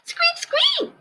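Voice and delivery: very high tiny squeak; squeaky mouse. Acted. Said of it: "said Mouse".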